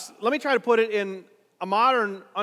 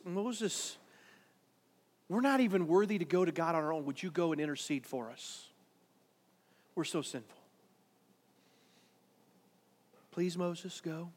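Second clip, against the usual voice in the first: soft begging; 3 semitones lower, 8 dB quieter than usual. Acted. People begging Moses for intercession.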